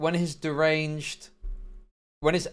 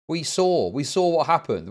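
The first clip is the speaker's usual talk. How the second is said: ordinary talk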